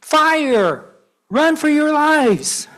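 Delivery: high, loud panicked yell; shouting alarm. Acted. Papa Bear wakes his family in the fire, shouting. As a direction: scream the warning to wake everyone.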